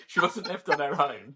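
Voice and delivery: ordinary talk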